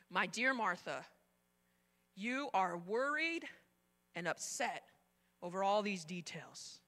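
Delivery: soft, slow loving words; tender. Retold or imitated. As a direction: gently reassure her. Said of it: "Can you hear the love in his voice?".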